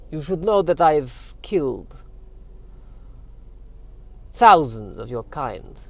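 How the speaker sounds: put-on affronted Dracula-style accent, falling away at the end; Eastern European vampire accent. Vampire menacing his questioner, Eastern European accent. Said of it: "Why do you have the accent".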